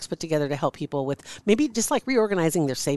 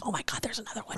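hushed whisper of discovery; whispered oh my God; 4 semitones higher, 13 dB quieter, faster than usual. Retold or imitated. Host recalling finding another clip, hushed whisper. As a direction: breathe it out, amazed. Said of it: "I'm like".

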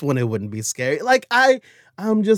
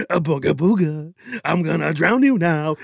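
hammed-up mock spooky ghost; shadowy ghost figure; 2 semitones higher, 4 dB louder than usual. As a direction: play a silly bogeyman making threats. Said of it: "shadowy figure being like".

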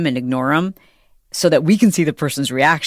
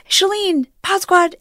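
high excited call across the airport; a fan calls out to her in the airport, high excited call; slower than usual. Retold or imitated. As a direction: call her name out as you run past, much higher. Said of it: "she ran by me, she was like".

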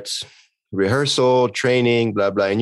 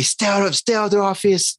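higher pitched imitation of an angry boss; mock angry order; 9 semitones higher than usual. Imitating the furious director ordering him out, mock angry. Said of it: "so he told me".

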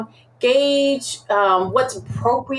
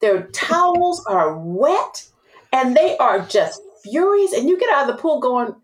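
ordinary talk, nothing marked

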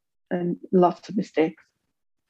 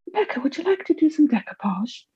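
high, sing-song carer voice talking down; mock a patronising day centre worker; condescending carer; 8 semitones higher than usual. Day centre worker offers decoupage, high patronising sing-song. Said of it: "she says".

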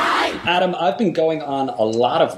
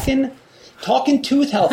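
singsong; 13 semitones higher than usual. Acted. Singsong ad hook.

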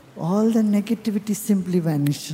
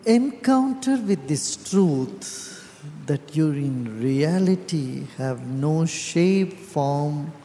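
guided meditation; 4 semitones lower than usual. Real, not acted. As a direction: guide them inward, calm and unhurried, with long pauses.

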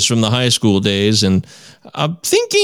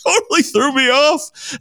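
laughing; 13 semitones higher, slower than usual. Real, not acted. Laughs while recalling prank.

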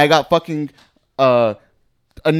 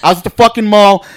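loud rant; 5 semitones higher, 9 dB louder than usual. Acted. Ranting about a traumatic mall incident.

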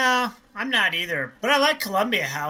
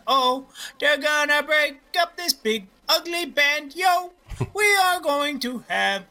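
a hammed-up bad British accent, higher; 9 semitones higher than usual. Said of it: "bad British accents".

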